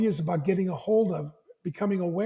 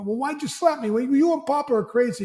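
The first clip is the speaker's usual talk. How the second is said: put-on higher, louder indignant kid voice; upset young boy; 6 semitones higher, 4 dB louder than usual. Young son protesting the slap to his father, higher and louder. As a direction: whine back like a wronged boy.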